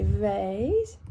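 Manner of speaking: vase rhymed with face, American style; American pronunciation of vase. Shown as a demonstration. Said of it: "If I was American, that'd be".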